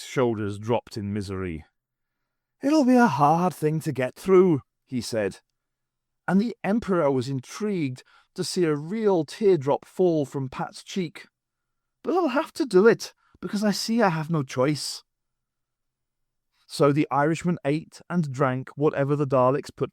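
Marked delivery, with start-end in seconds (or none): 2.6-4.6 high, hammed-up woeful whine; mock misery. A narrator's reading. 12.0-15.0 high, hammed-up woeful whine; mock misery. A narrator's reading.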